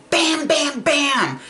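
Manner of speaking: loud, high knocking sound bam bam; imitated pounding. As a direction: pound on the door with your voice. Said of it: "he heard this loud noise".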